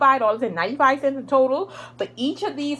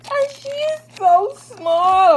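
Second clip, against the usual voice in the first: gushing; 10 semitones higher, 6 dB louder than usual. Real, not acted. Gushing over tiny camera.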